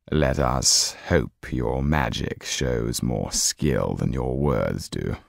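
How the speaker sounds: deep, slow aristocratic villain; cold menacing Count. Acted. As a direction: looks down on them from a great height. The Count replies coldly.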